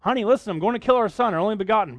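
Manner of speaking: hammed-up casual husband voice; mock breezy husband. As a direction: play Abraham breezily breaking terrible news. Abraham's imagined words to Sarah.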